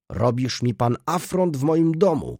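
high-pitched, affronted exclamation; indignant cry. A narrator's reading. Jan accuses his brother in his house, indignant cry. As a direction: protest with the pitch pushed up, not a full shout. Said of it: "krzyknął Jan".

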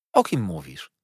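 mild narrated outcry; exclaimed question. A narrator's reading. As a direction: blurt the question out. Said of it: "zawołałem". (pytanie lekko wykrzyknięte)

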